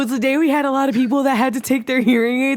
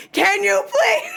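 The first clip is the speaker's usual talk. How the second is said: high, drawn-out holler; calling out; 11 semitones higher, much slower than usual. Retold or imitated. Stylist repeats for client who can't hear, calling out. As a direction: repeat it slowly for the hard of hearing.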